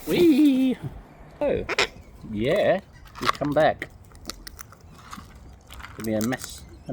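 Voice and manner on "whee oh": high gleeful whee; excited squeal. Real, not acted. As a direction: squeal it with delight. Cheering birds flying in.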